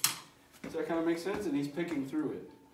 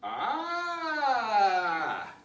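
startled cry; 4 semitones higher, 5 dB louder than usual. Real, not acted.

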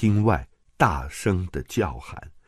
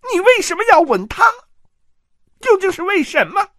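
loud, high, fast screaming demand; shrieking accusation; 9 dB louder, faster than usual. Acted. Jealous aunt screaming at her husband about the kiss. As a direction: hurl the question at him.

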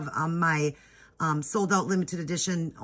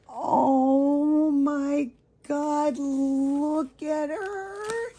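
high, slow, drawn-out gasp of delight; overwhelmed, high-pitched gush; excited squeal; 8 semitones higher, much slower than usual. Real, not acted. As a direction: melt over her, squealing. Unboxing the doll she always wanted.

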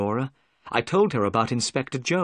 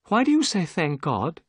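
put-on higher gentle young woman's voice; a lightly raised voice for Miss Morstan; young lady; 6 semitones higher than usual. Miss Morstan greeting Watson, higher and eager.

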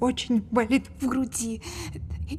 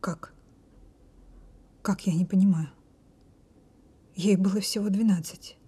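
low, breathless whisper of disbelief; stunned whisper; 4 semitones lower than usual. Acted. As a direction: barely get the words out in shock. Stunned by the revelation.

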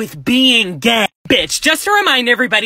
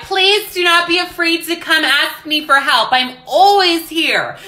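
mocking a sweet teacher; 4 semitones higher than usual. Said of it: "teachers that would be like".

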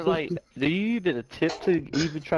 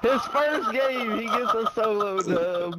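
laughing praise; 6 semitones higher, slower than usual. Real, not acted. Praising friend's solo win.

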